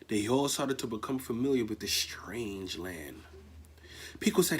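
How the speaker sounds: ordinary delivery, nothing marked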